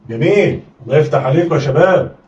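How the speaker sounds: ordinary talk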